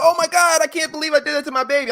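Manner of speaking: high, fast hammed-up gasp; mock horrified mom. Mom after slapping her son, high hammed-up voice. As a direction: overplay a guilty mother. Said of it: "He's like".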